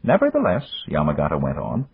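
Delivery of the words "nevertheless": put-on higher, measured voice of an old friend; Japanese magnate. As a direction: voice the older businessman, courteous and calm. Yamagata speaks.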